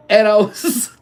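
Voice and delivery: breaking into laughter; laughing. Real, not acted. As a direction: laugh through the words. Laughing at own story.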